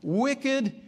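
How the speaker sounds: high disbelieving "Really? Wicked?" pitched far up; incredulous. Retold or imitated. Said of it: "And I'm thinking".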